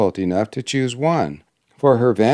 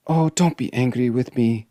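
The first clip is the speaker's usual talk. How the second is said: understated anxious plea; pleading cry; 3 semitones higher than usual. Acted. Princess begs the dwarf's pardon, pleading. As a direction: implore in a lightly raised voice. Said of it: "cried the princess, clasping her hands".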